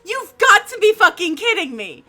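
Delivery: loud, high outraged yelling; real shouting fit at a chat filter; furious rant. Real, not acted. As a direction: rant at the screen, fully fed up. Ranting at automod blocking "bitch.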